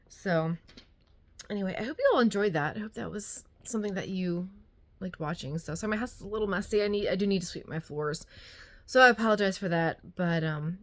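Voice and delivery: normal talking voice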